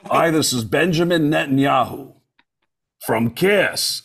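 put-on voice introducing himself as a celebrity; Benjamin Netanyahu. Shown as a demonstration. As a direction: demonstrate a politician impression. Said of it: "listen to Benjamin Netanyahu. It's the same voice".

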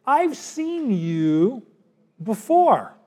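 slow, drawn-out suspicious accuser; slow accusing recognition. Retold or imitated. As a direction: stretch it out like you've caught him.

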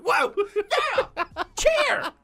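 squealing high-pitched cheering; high cheer imitation. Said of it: "Just like".

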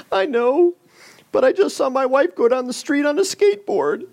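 man in heaven lamenting his wife's skateboard, comically tearful; mock heartbroken. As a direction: wail it like a brokenhearted husband. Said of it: "just crying, just heartbroken".

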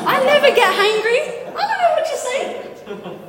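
high, giggling protest; laughing denial. Real, not acted. Speaker teasingly denying she ever gets hangry, giggling. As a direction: laugh off the accusation. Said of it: "joking denial of being hangry".

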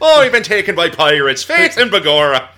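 put-on loud, high hammy Irish accent; mock Irish brogue. Captured sailors pretending to be Irish, loud mock Irish brogue. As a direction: play the stage Irishman to the hilt.

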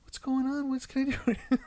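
exaggerated gentle father to toddler; sweet parent voice. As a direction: switch to sugary dad voice. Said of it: "I'm like".

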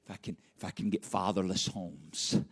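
low, hushed, plotting voice; sinister scheming devil. Retold or imitated. Preacher voicing the devil plotting against homes, hushed and sinister. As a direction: let the adversary whisper his plan. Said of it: "He says".

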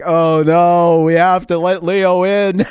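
loud, high, drawn-out mock dismay; mock group groan. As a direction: groan it out like a reluctant crowd, hammed-up. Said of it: "they were just a groan in the place".

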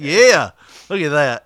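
loud, slow self-admiring gloat; hammed-up pride, pushed and slow; mock proud. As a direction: admire yourself out loud, drawn out. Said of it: "I was proud".

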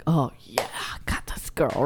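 imitating a cop whispering; hushed aside to his partner, mocking; whispered cop. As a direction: cop whispers about the girl he caught. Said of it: "this guy's like".

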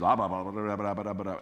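rapid run of nonsense syllables; quick mock gibberish; mimicked babbling. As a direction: babble without a break, imitating foreign chatter. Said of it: "she starts babbling".

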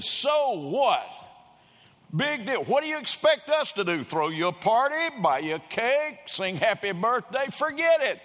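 high, loud, scornful retort; mock-yelled put-down. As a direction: sneer it back across the room. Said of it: "the other one yells".

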